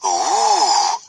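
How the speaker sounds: a hushed, awed ooh; excited ooh. A narrator's reading. Boys marvelling at the sleeping dragons, excited ooh. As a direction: breathe it out in wonder. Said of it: "muttered the boys excitedly".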